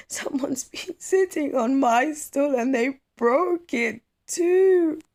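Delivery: put-on very high, loud, slow little boy's wail; shaken child. Kwaku finding his broken stool, wailing very high and loud. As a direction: cry it out like a shocked small child. Kwaku shocked, voiced in story.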